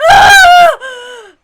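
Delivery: piercing high shriek; anguished scream. Acted. Ava breaking into a terrified scream. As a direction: cry out in terror. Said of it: "Ava panicked scream".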